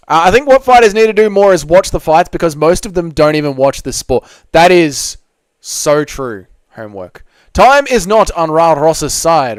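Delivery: ordinary talk, nothing marked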